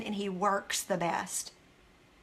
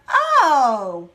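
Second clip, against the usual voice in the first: mock realization; 8 dB louder than usual. Acting out a failed idea sparking another, in loud realization. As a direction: let the idea land loudly, voice swooping down.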